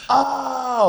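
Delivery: high, drawn-out dawning "oh"; mock realisation. People finally get the pun name, mock realisation. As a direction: let the penny drop in an exaggerated high swoop.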